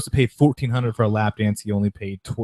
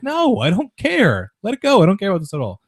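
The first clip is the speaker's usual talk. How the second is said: dismissive shrug; 9 semitones higher than usual. Retold or imitated. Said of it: "they're like".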